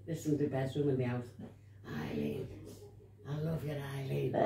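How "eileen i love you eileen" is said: low, soft crooning of her name; hushed night call. Retold or imitated. Imitating the husband's low, soft crooning at three in the morning. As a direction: mimic the husband calling softly in the dark.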